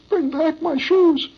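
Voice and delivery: put-on crying little boy. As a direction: call out like a heartbroken boy. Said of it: "and the boy says".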